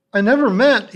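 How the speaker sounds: high, loud frightened blurt; fearful. A narrator's reading. Ernest caught by his teacher, fearful and high. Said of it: "he began fearfully".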